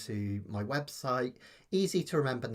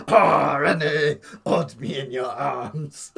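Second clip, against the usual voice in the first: hammed-up French accent, loud and slow; mock French sitcom lover, loud and slow; bad French accent; 4 semitones higher, 16 dB louder, slower than usual. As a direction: swoon it in a comic French accent. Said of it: "I could do the bad French accent".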